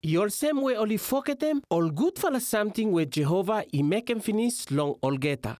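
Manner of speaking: ordinary talk, nothing marked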